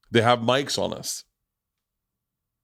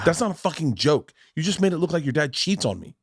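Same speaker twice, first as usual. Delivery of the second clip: higher-pitched mock scolding; berating; 6 semitones higher than usual. Mother to daughter over a joke, berating. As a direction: imitate an indignant mother laying into a child. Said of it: "berated her".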